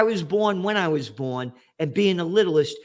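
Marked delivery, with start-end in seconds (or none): none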